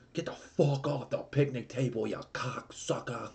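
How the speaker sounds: high-pitched mock New York cop, last word stretched; New York accent. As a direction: imitate an irate New Yorker ordering people off. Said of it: "like, in this New York accent".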